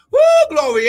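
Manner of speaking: high, loud praise whoop; full-voiced church whoop; shouted whoop. Real, not acted. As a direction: let out a ringing whoop of glory. Praise eruption.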